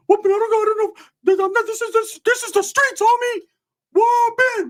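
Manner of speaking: squeaky, stammering, whooping rant; Ricky. Retold or imitated. Wired cousin protesting to narrator, high whooping imitation. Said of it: "Ricky busting the motherfucker just whooping".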